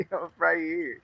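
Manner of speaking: high mock call-out through laughter; screaming for attention. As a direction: laughingly play the attention-seeking outfit. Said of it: "that mask is screaming".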